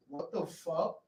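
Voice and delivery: quiet, dazed question; dazed mumble. Retold or imitated. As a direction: imitate someone groggily coming to, softly. Woman coming to after fainting.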